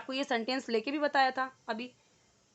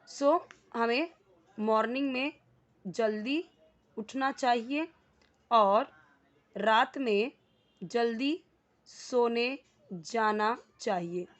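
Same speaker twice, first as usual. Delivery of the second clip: slow, spaced words for the class to repeat; slow model sentence; slower than usual. Shown as a demonstration. Teacher modelling Hinglish for students, slow and spaced. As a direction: lay out each word with a pause. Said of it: "teacher models the Hinglish sentence".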